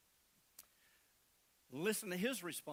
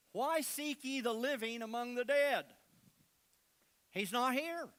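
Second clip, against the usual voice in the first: loud angel proclamation; 5 semitones higher, 6 dB louder than usual. Acted. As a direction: ring out like a herald angel, raised and high. Angel announces the resurrection.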